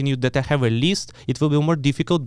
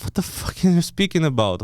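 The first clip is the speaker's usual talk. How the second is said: incredulous laugh; 3 semitones higher than usual. Retold or imitated. Laughing disbelief at border guard.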